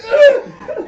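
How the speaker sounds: sharp high disgusted "ugh"; a loud, high yelp of disgust; disgusted groan. Retold or imitated. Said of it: "As Kit's like".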